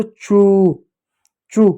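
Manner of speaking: चू with the "oo" stretched out; चू drawn out as "chooo"; sound demonstration. Shown as a demonstration. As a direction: hold the vowel in चू so it can be heard. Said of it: "उसकी ध्वनि आप कैसे पहचान सकते".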